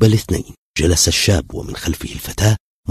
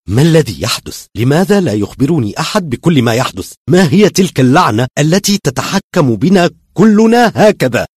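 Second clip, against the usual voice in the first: loud high outburst, building to a shout; angry, forceful voice; 10 semitones higher, 7 dB louder than usual. A narrator's reading. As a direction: demand answers, escalating to full fury. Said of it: "تعصب الشاب وقال بصوت قوي". (بصوت قوي غاضب)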